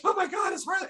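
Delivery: high, squealing fanboy shout; starstruck kid. Retold or imitated. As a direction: gush like a ten-year-old meeting his hero. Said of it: "I was just like a little kid".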